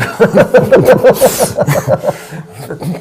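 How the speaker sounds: loud, high burst of laughter; real high-pitched belly laugh; hearty laugh. Real, not acted. Laughing at own special brew story.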